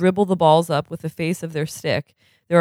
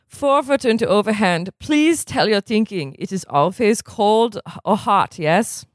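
lightly put-on German accent, formal and measured; a stern German coach scolding in a mild German accent; 4 semitones higher than usual. Stitt lecturing sluggish players at dawn drills, mild German accent. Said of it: "do the accent again".

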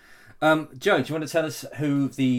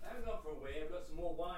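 far off; 5 semitones higher, 15 dB quieter than usual. Real, not acted. Speaks from far away.

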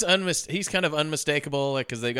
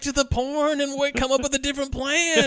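mock criminal; 11 semitones higher than usual. Voicing the criminals' doubts.

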